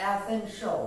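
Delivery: essential as e-sen-shol; e-sen-sho for essential; correct pronunciation. Shown as a demonstration. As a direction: say essential with a one-beat shol ending. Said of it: "we have to pronounce it".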